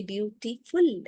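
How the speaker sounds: "beautiful" in separate syllables, beau-ti-ful; broken into syllables. Shown as a demonstration. Teacher showing children a word that can split, "beautiful" broken into syllables. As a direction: pull "beautiful" apart syllable by syllable. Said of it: "You can break it".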